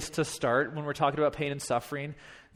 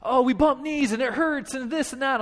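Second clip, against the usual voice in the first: high-pitched mock whimpering boys; imitated crying kids; 7 semitones higher than usual. Boys telling coach about bumped knees, imitated crying. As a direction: whine it like hurt little kids. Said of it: "here come two more dudes crying".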